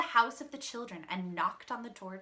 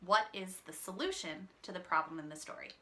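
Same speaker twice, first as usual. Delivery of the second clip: ordinary delivery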